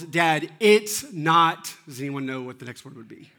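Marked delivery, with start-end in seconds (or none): 0.0-1.7 put-on loud, very drawn-out child's protest; mock tearful-kid outburst, slow and loud; imitated yell. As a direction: yell it word by word, stretched out. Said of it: "And then he yells".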